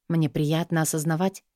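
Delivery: usual speaking voice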